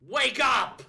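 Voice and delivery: loud, high shout; wake-up call. Acted. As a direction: yell to rouse someone out of sleep. Reading game dialogue, rousing someone.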